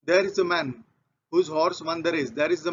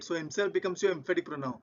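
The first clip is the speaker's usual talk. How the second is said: usual speaking voice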